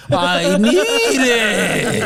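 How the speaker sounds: high, drawn-out desperate croak; SpongeBob desperate for water. Acted. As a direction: stretch each word out, desperate for water. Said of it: "That's what SpongeBob was trying to".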